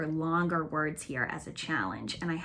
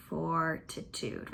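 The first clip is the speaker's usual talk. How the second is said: slowly stretched; 6 dB quieter, much slower than usual. Shown as a demonstration. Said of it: "You can hear the or".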